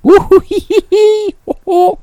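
high mocking woo-hoo cackle; mocking laugh. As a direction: tease with a silly hooting laugh. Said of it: "I'll just make fun of you".

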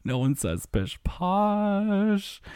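slow, high, stretched final word; drawn-out disbelief. Real, not acted. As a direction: draw out POSH in amused disbelief. Repeats the absurd phrase slowly.

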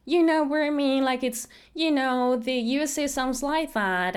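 mock American valley girl accent; Hollywood girl accent. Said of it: "suena todo como Bollywood".

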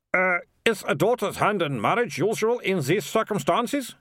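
high, lilting official's voice; tentative mayor feeling out a deal; high-pitched, uncertain asking; hesitant mayor. Acted.

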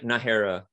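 "Nahara" as nuh-HAIR-uh, with a breathed H. Shown as a demonstration. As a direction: demonstrate "Nahara" with H for the J and "air" in the middle. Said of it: "the J is like an H".

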